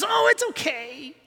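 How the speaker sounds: high, limp, whiny simper; pathetic weak voice. Retold or imitated. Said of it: "weak, pathetic sadness of Christians that just goes".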